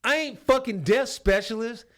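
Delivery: loud, high angry bellow at a private; sergeant yelling. Retold or imitated. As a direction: chew him out like a furious sergeant. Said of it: "and he's like".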